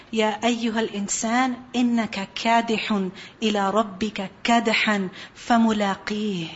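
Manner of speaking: quick, fluent Arabic recitation; Quran recitation. Acted. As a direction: recite the verse in one flowing breath.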